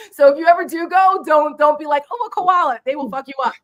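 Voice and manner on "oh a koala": high, loud mock cooing; gushing tourist. Warning friends off petting koalas, mock gushing. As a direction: coo like a smitten tourist, pitched way up.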